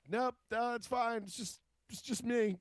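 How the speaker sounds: high, sheepish reassurance; embarrassed brush-off. Retold or imitated. Screamer calls back to neighbors, sheepishly high. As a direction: wave them off, a bit flustered.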